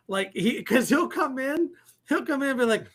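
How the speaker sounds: ordinary talk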